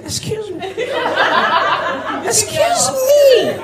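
very high, slow, stretched excuse me; high drawn-out demon call. Acted. Demon politely calling the walking comedian, very high and slow. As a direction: call him sweetly in a thin high demon voice.

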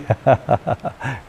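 light rippling chuckle. Real, not acted. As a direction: laugh off your own aside. Amused at the texts.

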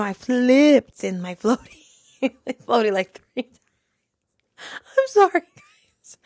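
breaking up with laughter mid-sentence; real laughing fit. Real, not acted. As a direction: try to get through it while cracking up. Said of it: "Let me get through this".

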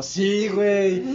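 slow cool street drawl. Retold or imitated. Acted reply in retold call.

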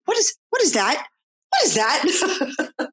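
high, then slow wondering question; amazed little kid. Retold or imitated. Mom imitating her son hearing the bells, high and amazed.

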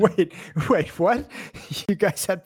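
incredulous, high-pitched laughing double take; amused, real shock, pitch jumping up; laughing disbelief. Real, not acted. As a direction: blurt it out through a laugh, astonished.

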